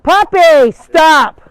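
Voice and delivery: high, loud stop to the puppy; sharp loud command. Real, not acted. Owner ordering her puppy to stop chasing the goats, loud. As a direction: shout him off mid-chase.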